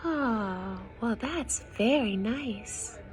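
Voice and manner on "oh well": usual speaking voice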